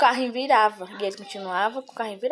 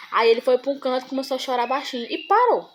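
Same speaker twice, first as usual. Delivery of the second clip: ordinary talk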